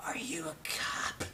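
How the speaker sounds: slow, unvoiced whisper from behind the door. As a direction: whisper it suspiciously through a cracked door. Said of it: "voice whispers out of the darkness".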